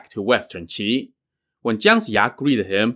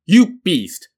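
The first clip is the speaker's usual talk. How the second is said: sharp, raised, contemptuous cry; scornful outburst; 7 semitones higher, 5 dB louder than usual. Acted. Master scorning his disobedient pupil, raised angry retort. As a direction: spit the insult at him. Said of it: "Pure Essence scoffed".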